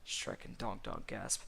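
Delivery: usual speaking voice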